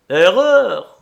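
loud, high cry of triumph; triumphant exclamation. A narrator's reading. Bill corrects Henri's count of the dogs, triumphant exclamation. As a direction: ring it out triumphantly. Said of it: "s'exclama Bill triomphant".

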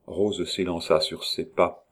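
plain reading voice

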